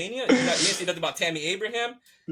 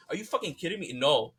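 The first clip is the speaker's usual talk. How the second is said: usual speaking voice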